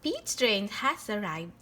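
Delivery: usual speaking voice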